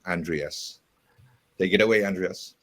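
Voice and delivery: usual speaking voice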